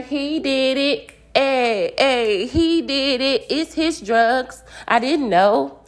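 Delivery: hammed-up, high snitch voice; snitching like a canary. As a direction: tattle eagerly, pretending to be questioned. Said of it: "singing like a canary".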